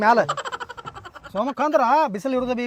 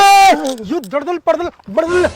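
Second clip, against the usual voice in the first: gibberish shout; 5 semitones higher, 9 dB louder than usual. Acted. Foreigner yells gibberish insult.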